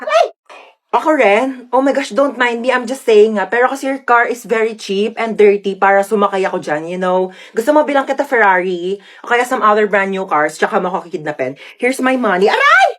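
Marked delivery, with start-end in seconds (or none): 0.0-0.3 sudden high yelp; sharp startled cry; shocked squeal. Acted. 12.5-12.9 sudden shrill ouch; sharp pained cry; high yelp of pain. Acted.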